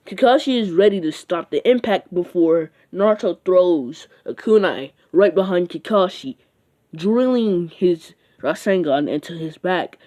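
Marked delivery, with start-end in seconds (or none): none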